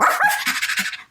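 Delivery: high cartoon dog yap; dog bark. Shown as a demonstration. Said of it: "I played the dog on that show".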